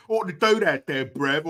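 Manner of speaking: mock British slang. Streamer mocking people who say brev, mock British accent. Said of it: "That's the British equivalent to bro".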